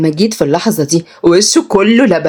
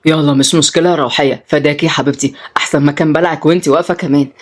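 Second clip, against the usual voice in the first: put-on consoling young man; 4 semitones lower than usual. Tutor consoles the girl over spilt milk, low and kind. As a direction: comfort her low with a light joke.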